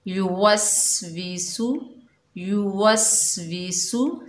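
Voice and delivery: युवस्विसु slowly with a whistling स; whistled seen. Shown as a demonstration. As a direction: draw out युवस्विसु, letting the स whistle. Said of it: "सीन को सीटी से अदा करेंगे".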